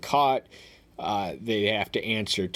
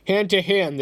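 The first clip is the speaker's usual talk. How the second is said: higher, louder, solemn proclamation of the verse; scripture reading; 7 semitones higher, 5 dB louder than usual. Acted. Reads scripture aloud.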